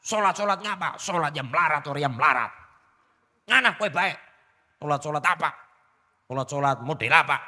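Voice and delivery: acting a rude man who refuses prayer, mocking; rude refusal. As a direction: scoff at the invitation to pray. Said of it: "Nolak tapi kasar".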